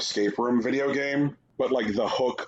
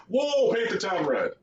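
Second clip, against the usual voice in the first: high, mock-amazed exclamation; mock excitement; 10 semitones higher than usual. Reviewer voicing a bar fight game's hype, mock excitement. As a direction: put on a wowed, high-pitched hype voice.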